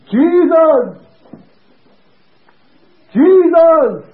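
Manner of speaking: very high, loud cry; full-force shout for the whole ward to hear; retold shout. Preacher crying out over a sick man, high shout. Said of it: "I suppose the whole ward heard".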